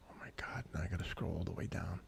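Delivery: very soft, whispered at the start; muttering to self. Real, not acted. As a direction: grumble it under your breath.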